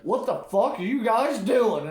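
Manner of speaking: high, loud mock yell at his buddies; shouting what are you doing; retold shout. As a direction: bark it out like a startled friend. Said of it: "And he's like".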